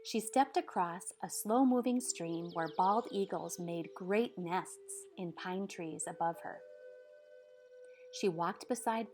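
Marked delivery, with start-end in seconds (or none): none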